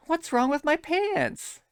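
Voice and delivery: hammed-up wounded pride; squeaky mock outrage; offended Jinx. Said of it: "And Jinx goes".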